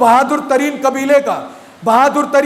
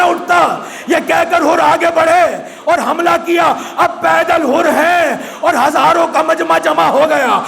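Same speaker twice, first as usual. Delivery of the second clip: raised, ringing lament; preaching at full force; 4 semitones higher than usual. Acted. Preacher telling Hurr's last stand, in a ringing cry. Climactic recitation.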